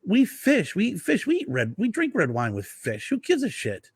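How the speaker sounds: high dismissive rant; Italian guy scoffing. Retold or imitated. Winery guy dismissing wine pairing rules, high and scoffing. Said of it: "He goes".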